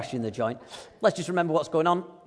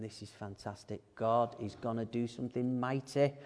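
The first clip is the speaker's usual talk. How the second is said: much softer, hushed inner voice; Moses thinking; 4 semitones lower, 9 dB quieter than usual. Retold or imitated. Moses privately thinking to himself, hushed. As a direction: murmur it like a private thought. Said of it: "I bet he's thinking".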